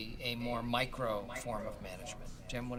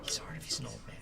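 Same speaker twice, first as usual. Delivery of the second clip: low hushed whisper to the side; whispered aside; 5 semitones lower, 5 dB quieter, much faster than usual. Real, not acted. Boss whispering at his salesman mid-call, hushed low whisper. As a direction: hiss a quick correction under the breath.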